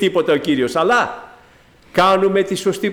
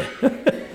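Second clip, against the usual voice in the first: a short airy laugh, softer; breathy laugh; 5 dB quieter than usual. Real, not acted. Preacher laughing at his ironic joke, soft breathy laugh. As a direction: laugh quietly at your own joke.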